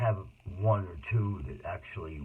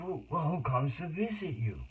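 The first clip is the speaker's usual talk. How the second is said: raised upbeat asking; upbeat probing, higher pitch; cheery question; 8 semitones higher than usual. Retold or imitated. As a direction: keep the cheerful visitor tone.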